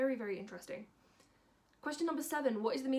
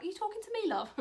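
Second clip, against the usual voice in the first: high-pitched mock customer question; puzzled customer; 11 semitones higher, faster than usual. Imitates customers questioning her.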